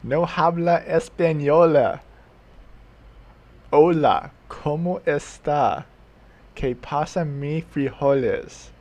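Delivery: jokingly butchering Spanish phrases; bad mock Spanish. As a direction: lay on a deliberately bad Spanish accent, drawn out. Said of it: "speak really, really bad Mexican or Spanish".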